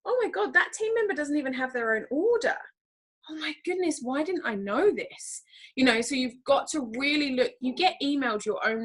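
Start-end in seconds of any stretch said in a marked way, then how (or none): 0.0-5.4 higher-pitched, retold shock; a touch of panic, imitating herself; mild mock alarm. Imitating herself on pulling reports, mildly scared. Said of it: "it scared me because".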